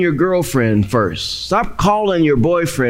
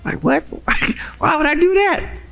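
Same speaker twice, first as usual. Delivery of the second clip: much higher incredulous voice with a laugh; mock congregation reaction; 12 semitones higher, faster than usual. Preacher mimics doubtful listeners, mock disbelief. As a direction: play the doubter, baffled. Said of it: "That don't even sound right to some of y'all".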